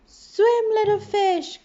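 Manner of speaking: loud, high shout of warning; urgent cry. A narrator's reading. Friends warn little fish to flee, loud and high. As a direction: yell a warning to run. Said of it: "cried Danny and Spike".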